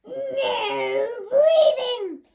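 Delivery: slow, drawn-out loud teacher command; strict teacher. Retold or imitated. Teacher barking at the class, slow and loud. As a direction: bellow it like a tyrant teacher. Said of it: "stand there at the front going".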